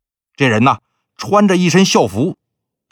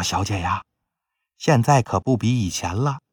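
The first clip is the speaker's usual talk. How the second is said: much softer, hushed consoling; softly coaxing; 3 semitones lower, 7 dB quieter than usual. Acted. Maid quietly urging her weeping young mistress, much softer hushed plea. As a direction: keep it low, like comforting someone who mustn't be overheard. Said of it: "就在边上小声劝".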